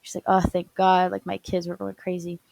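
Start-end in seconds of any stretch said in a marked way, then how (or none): 0.3-1.1 loud burst of relief; relieved loud thanks. Retold or imitated. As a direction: blurt out thanks, much louder. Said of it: "my mom was thanking him profusely".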